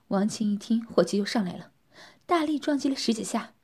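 ordinary delivery

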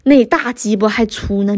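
ordinary delivery

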